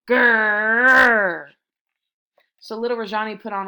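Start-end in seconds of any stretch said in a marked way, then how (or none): 0.1-1.5 roaring tiger growl, much louder. A narrator's reading. The angry tigers growl at Rajani, roaring loud. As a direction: let out a big angry growl. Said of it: "they could only say".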